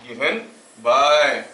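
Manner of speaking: word by word, drawn out, with long pauses; slow dictation. Real, not acted. Teacher giving students notes to write, slow dictation. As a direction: dictate slowly with long stretched syllables.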